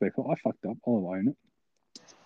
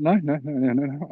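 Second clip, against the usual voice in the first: higher-pitched backpedalling, acted out; hasty denial; 5 semitones higher than usual. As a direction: deny it all in a mock panic. Hastily denying the muttered insult.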